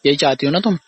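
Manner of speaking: normal talking voice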